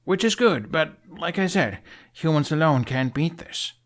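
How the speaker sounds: young hero insisting, lightly voiced; insistent Hiccup. A narrator's reading.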